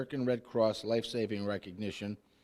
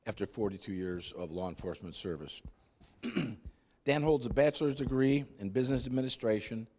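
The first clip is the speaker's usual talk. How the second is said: usual speaking voice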